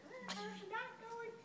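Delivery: high, slow mocking tease; teasing. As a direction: tease her with mock concern. Group teasing her.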